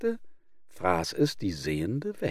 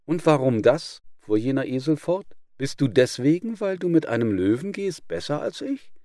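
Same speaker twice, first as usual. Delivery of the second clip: indignant donkey; 5 semitones higher, 4 dB louder than usual. Acted. Offended donkey protesting.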